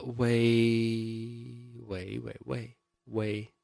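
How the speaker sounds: very soft, trailing wei repeated; soft wei demo. Shown as a demonstration. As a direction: murmur wei under the breath. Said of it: "demonstrates wei sounds softly".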